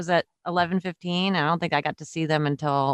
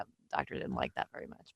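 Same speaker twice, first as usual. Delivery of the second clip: soft whispered punchline; whispered aside; 14 semitones lower, 15 dB quieter than usual. Real, not acted. Telling a friend how the doctor reacted, whispered aside. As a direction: drop to a whisper for the wry aside.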